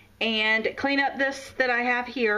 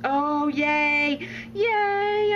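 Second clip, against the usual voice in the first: delighted cheer; 4 semitones higher, 3 dB louder, much slower than usual. Real, not acted. Cheering at colors.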